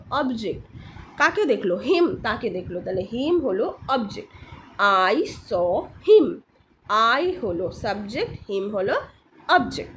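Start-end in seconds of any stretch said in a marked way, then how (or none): 5.1-6.3 very slow, word by word; deliberate classroom example reading; slow model sentence. Shown as a demonstration. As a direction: stretch each word out for learners.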